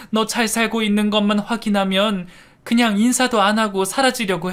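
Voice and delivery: high, weepy pleading; tearful apology. A narrator's reading. Mother tearfully apologizing to her abandoned daughter. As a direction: cry your guilt out. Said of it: "계속해서 눈물을 흘리셨죠".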